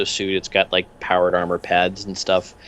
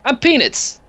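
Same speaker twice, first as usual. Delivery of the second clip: much higher, cheery odd little thug; much higher goofy henchman voice; Peanuts voice. Acted. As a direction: chirp the question brightly.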